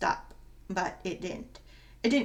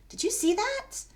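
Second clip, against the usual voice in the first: high, astonished disbelief; amazed question; 13 semitones higher than usual. Acted. Malia asks her friend, high and astonished. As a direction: gasp it out, much higher, amazed. Malia sees the dime vanish.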